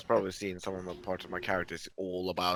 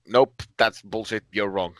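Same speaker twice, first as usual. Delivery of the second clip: louder, slow no-nonsense shutdown; blunt dismissal; 8 dB louder, slower than usual. Retold or imitated. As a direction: flatly cut him off like the sister who takes no bullshit. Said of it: "It's like".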